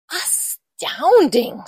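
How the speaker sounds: slow, high, drawn-out wonder; amazed monster. Acted. Gruffalo marvels at the mouse, slow amazed voice. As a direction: stretch the word in astonishment.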